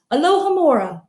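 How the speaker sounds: ordinary delivery, nothing marked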